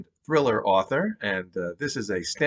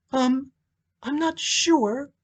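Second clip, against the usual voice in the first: hesitant widow; slower than usual. Acted. Monica's voice in story.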